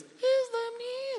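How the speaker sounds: tiny, very high, slow squeaky voice; little squeak. Retold or imitated. Little girl calling out in church, mouse-like squeak. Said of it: "It's like this little squeak".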